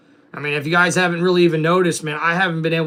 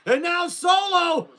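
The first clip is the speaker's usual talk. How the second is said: higher, excited wrestling commentator call; play-by-play commentary; 9 semitones higher, slower than usual. Acted. Streamer calling the wrestling game for chat, excited play-by-play. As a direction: call the match like a TV announcer.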